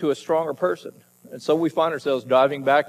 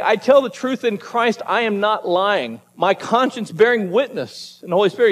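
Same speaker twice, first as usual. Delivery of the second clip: higher-pitched, emphatic quoting of scripture; emphatic insistence; 5 semitones higher than usual. Retold or imitated. Preacher quoting Paul to the congregation, emphatically insisting. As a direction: press each phrase home as sworn truth. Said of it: "say something so emphatic".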